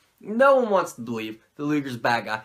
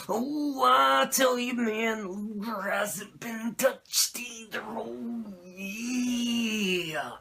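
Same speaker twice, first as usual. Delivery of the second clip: Macho Man impression; 5 semitones higher, 6 dB quieter, much slower than usual. Macho asking Sting about the Dungeon of Doom, in a growling Macho Man voice. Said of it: "Macho Man's like".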